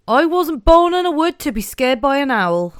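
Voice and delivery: put-on Norfolk accent. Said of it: "what does this mean".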